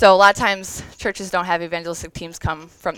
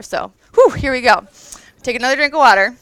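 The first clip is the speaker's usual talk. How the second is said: composing herself; 3 semitones higher, 7 dB louder than usual. Real, not acted. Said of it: "I'm already emotional".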